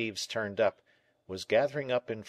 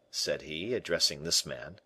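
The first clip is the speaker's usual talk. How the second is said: ordinary delivery, nothing marked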